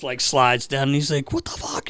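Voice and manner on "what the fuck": hushed imitation of a teammate's accent; Peter Svoboda's accent. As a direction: quietly mimic the teammate's accented bafflement. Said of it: "You know, in his accent".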